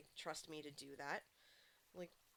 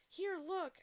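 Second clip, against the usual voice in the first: higher, louder eager call; eager retelling; 11 semitones higher, 7 dB louder than usual. Retold or imitated. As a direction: urge them to look. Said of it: "And he's like".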